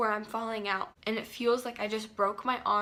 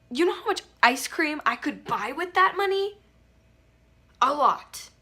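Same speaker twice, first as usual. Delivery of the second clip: loud, much higher gushing aside; excited aside; 6 semitones higher, 9 dB louder than usual. Acted. Narrator joking to viewers about buying ice cream. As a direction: burst out with playful glee.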